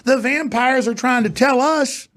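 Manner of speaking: high, strained indignation building to slow emphasis; indignant rant. Real, not acted. Host railing at those telling producers how to live, indignant climax.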